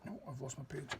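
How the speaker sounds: aside. Real, not acted. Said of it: "reader loses her place".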